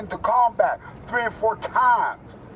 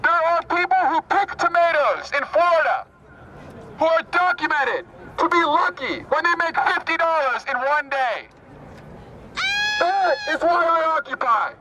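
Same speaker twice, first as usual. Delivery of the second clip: rally speech declamation; 8 semitones higher than usual. Real, not acted. Activist addresses an Occupy rally, declaiming phrase by phrase. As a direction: declaim in short phrases with long pauses.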